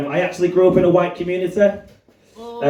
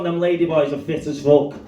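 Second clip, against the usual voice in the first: ordinary delivery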